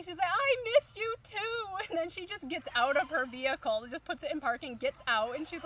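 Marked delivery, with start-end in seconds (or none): 0.3-1.9 high, loud, stretched-out shout; screaming back. Retold or imitated. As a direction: holler it back to a long-missed friend. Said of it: "she starts screaming back to me".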